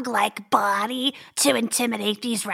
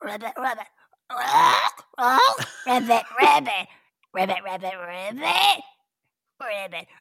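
frog ribbits. Pretend. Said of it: "Here I go".

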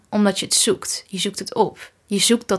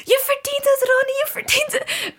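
high cheering, much higher; cheering fan. Retold or imitated. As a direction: cheer him on from the crowd. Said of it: "keihard zitten dansen en schreeuwen".

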